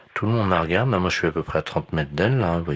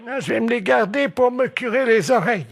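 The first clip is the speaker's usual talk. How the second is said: high granny; matter-of-fact old lady; 4 dB louder than usual. Pretend.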